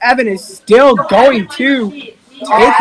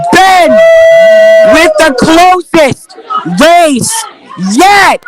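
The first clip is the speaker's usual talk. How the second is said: rising higher and louder as the race tightens; building excitement; 8 semitones higher, 4 dB louder than usual. Acted. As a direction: climb from eager to near-shouting. Close race, commentator building up.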